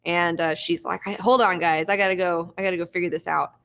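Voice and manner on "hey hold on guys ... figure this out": ordinary delivery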